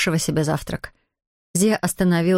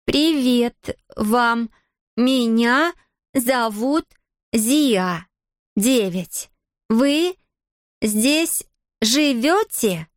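very slow, higher-pitched, word by word; spaced-out words, each carefully articulated; slow, distinct enunciation; 5 semitones higher, much slower than usual. A narrator's reading. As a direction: speak as if to someone who barely knows the language. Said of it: "чётко и раздельно произнося слова".

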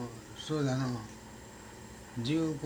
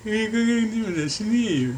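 higher, louder quoting of the son; young son's voice; 11 semitones higher, 5 dB louder than usual. Retold or imitated. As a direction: voice the boy, pitched up and loud. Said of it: "大学二年も行った言うのが".

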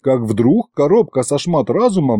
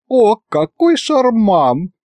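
high, drawn-out gushing; tittering, affected rapture; giggly delight; 10 semitones higher, slower than usual. A narrator's reading. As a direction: coo it out with a touch of a giggle. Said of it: "хихикнула Маша".

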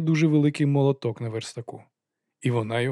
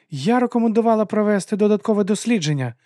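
high, louder objection from a lady; insistent protest; 9 semitones higher, 5 dB louder than usual. A narrator's reading. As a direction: argue back in a much higher voice.

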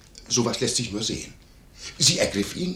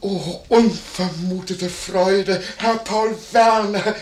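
high, loud, drawn-out greeting; effusive delight; 8 semitones higher, 5 dB louder, much slower than usual. Acted. Innkeeper greets unexpected guest Werner, effusively delighted. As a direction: exclaim with overflowing joy, stretching the words.

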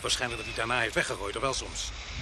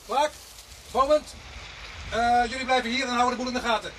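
raised voice calling the men over; 9 semitones higher than usual. Acted. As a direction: call out across the yard. Calling out to officers across scene.

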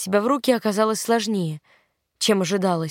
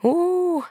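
high drawn-out sympathetic ooh; a TV host groaning at a painful replay; wincing oooh; 9 semitones higher than usual. A narrator's reading. TV host reacting to a brutal foul, high wincing oooh. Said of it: "раздался закадровый голос одного из ведущих".

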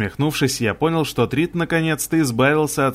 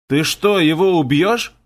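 louder, pitch lifted into a startled question; alarmed exclamation; 4 semitones higher, 3 dB louder than usual. A narrator's reading. Commander to the narrator about killing his fighter, alarmed exclamation. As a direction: exclaim it in disbelief, voice jumping up. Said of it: "воскликнул Хагл".

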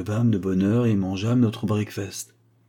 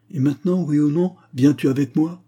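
challenging demand; 5 semitones higher, 5 dB louder than usual. A narrator's reading. Narrator challenges Goodloe to follow.

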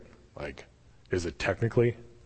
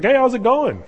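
much higher, mock-casual hail; cheery call-out; 12 semitones higher, 4 dB louder than usual. Jesus standing on shore hails Peter, high cheery call-out. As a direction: sing out a breezy greeting.

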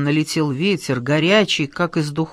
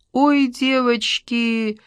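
high, drawn-out plaintive wail; 7 semitones higher, much slower than usual. A narrator's reading. Томка complaining to her roommates about the climate, plaintive. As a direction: whine it plaintively, slow and high. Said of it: "жалобно сказала Томка".

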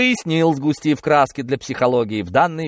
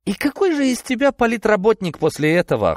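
cold; 10 semitones higher, 3 dB louder than usual. A narrator's reading. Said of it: "холодно спросил Кудрявцев".

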